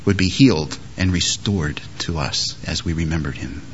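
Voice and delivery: ordinary delivery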